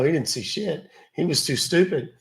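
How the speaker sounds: ordinary talk, nothing marked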